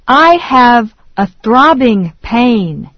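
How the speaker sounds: ordinary delivery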